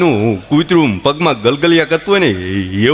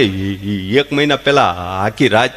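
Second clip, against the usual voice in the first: mock stammer; 6 semitones lower than usual. Stammerer tells story.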